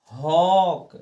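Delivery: हॉक said loud with a long open aw vowel; loud teaching demo of हॉक with open aw vowel; aw sound demo. Shown as a demonstration. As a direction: stretch हॉक out with a clear aw. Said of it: "ओ की मात्रा लगाकर पढ़ेंगे".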